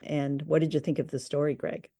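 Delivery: ordinary talk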